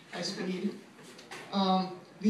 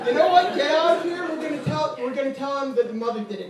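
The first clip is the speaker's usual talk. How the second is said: a cop suddenly yelling, much higher and louder; mock cop shouting; 4 semitones higher, 7 dB louder than usual. Cop to the driver, overexcited and hammed-up shouting. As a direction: whip up into an eager, hammed-up holler. Cop getting carried away, conspiratorial.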